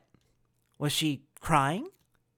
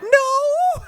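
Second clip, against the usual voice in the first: broken scream; 11 dB louder than usual. Acted. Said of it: "June was screaming, her voice broken".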